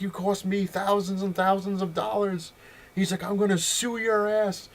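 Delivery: high, frantic yells for the cops; furious yelling. Retold or imitated. Store owner yelling at everyone to call the cops, furious. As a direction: bellow for the police, outraged.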